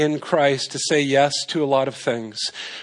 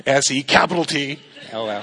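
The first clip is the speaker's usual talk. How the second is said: spelling it out in a lightly put-on funny voice; mock-serious spelled-out letters; silly spelling voice; 3 semitones lower than usual. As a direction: clown through the spelling. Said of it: "I don't know why I'm talking like that".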